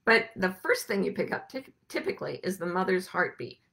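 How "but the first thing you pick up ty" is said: ordinary talk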